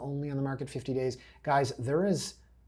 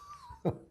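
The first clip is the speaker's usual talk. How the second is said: real helpless high-pitched giggle; stifled high laugh; high squealing laugh; 8 dB quieter than usual. Real, not acted. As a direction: crack up in a squeal. Cracks up before explaining.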